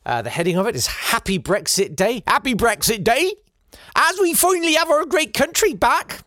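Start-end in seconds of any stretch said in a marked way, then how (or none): 2.3-6.2 high, put-on Norfolk poster voice; mock Norfolk accent. Comedian reading out a Brexit poster, high mock Norfolk accent. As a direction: read it in a deliberately wrong Norfolk accent. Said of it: "I know that's not how they talk".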